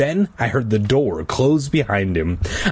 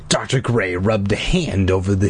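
usual speaking voice

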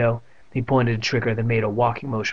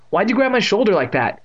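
startled; 9 semitones higher, 4 dB louder than usual. A narrator's reading. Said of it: "he asked, his heart still pounding".

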